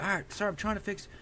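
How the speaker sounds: high-pitched retold appeasement; placating. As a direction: plead your case to an irate man. Placating an angry man, acted.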